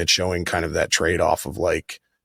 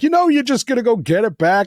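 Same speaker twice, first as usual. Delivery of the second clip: much higher, louder mock son; mock Harry telling mother to buy it back; imitating Harry; 5 dB louder than usual. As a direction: toss it off like a spoiled son, pitched up. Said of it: "He's like".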